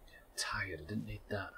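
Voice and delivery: breathy whisper, tired and shaken. Real, not acted. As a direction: whisper it to yourself, worn out. Startled in a hardcore playthrough.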